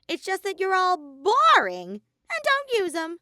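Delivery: mock them for being boring; Monokuma voice. A narrator's reading. Monokuma teasing Shuichi, high and loud.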